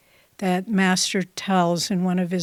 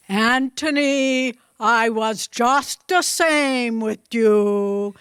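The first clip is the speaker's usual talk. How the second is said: put-on Bengali-accented English, tender and slow; 3 semitones higher, slower than usual. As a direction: imitate a loving Bengali guru, slow and raised. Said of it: "Master said in his wonderful Bengali accent".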